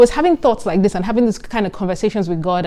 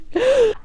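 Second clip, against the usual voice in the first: a sharp, high gasp of delight; a quick, high excited gasp. Real, not acted. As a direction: gasp as if remembering something great. Excited gasp before the next topic.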